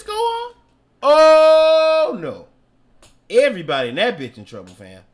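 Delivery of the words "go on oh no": slow rise to a loud high oh no; slow, high, loud mock horror; imitating a shocked mama. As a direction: draw it out like a shocked mother.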